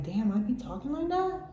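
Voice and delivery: a touch of disbelief at yourself; amused realization. Retold or imitated. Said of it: "I'm like".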